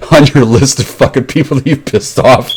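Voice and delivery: talking through giggles; laughing. Real, not acted. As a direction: break up laughing around the words. Laughs while explaining the prank.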